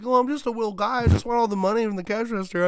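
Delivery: high, loud, sing-song robber cooing; cutesy little guy voice. Retold or imitated. Robber asking the cashier for money, cutesy. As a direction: coo it like a harmless little critter. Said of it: "he's doing it all ooh woo".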